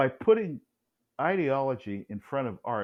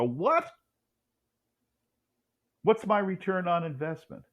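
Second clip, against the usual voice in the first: higher-pitched, incredulous donor; mock indignation; 8 semitones higher than usual. Donor asked for a million dollars, mock indignation. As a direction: imitate a donor balking at the cost. Donors balk at money request.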